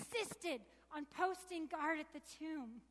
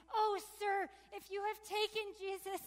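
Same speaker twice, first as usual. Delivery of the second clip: higher, louder pleading cry; Mary pleading with the gardener, raised and desperate; exclaimed; 4 semitones higher, 3 dB louder than usual. Acted. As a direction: beg him urgently through tears. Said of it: "I exclaimed".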